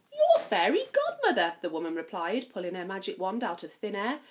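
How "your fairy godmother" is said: much higher, bright and cheerful; sing-song kindly fairy; fairy godmother. Acted. Fairy godmother introduces herself to Cinderella, high and bright.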